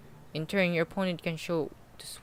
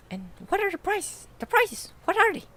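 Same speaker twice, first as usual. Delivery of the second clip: put-on squeaky, much higher excited girl; hyper little girl; 9 dB louder than usual. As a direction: bounce off the walls, high and eager. Voices hyper girl Uliana.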